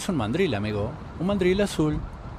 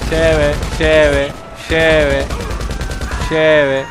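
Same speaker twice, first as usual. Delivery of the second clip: mock hawker calling lleve, louder; street vendor call; 2 semitones higher, 6 dB louder, much slower than usual. Gamer mock-selling to a downed enemy, hawker cry. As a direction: call out like a market seller.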